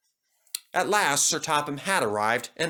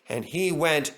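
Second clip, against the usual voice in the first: ordinary delivery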